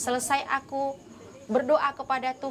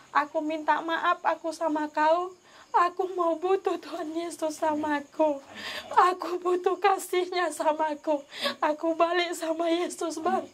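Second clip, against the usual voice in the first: real crying, voice pitched higher; tearful pleading; 4 semitones higher than usual. Real, not acted. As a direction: beg your husband through tears. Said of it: "aku menangis sama dia".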